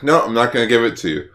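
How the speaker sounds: hammed-up urgent telling-off; mock exasperation. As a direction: build from refusal to indignant demand. Acted computer's angry refusal.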